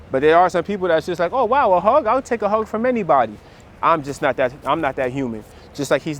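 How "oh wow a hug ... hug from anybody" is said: mock gushing hugger. Mimicking huggy people.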